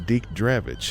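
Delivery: plain reading voice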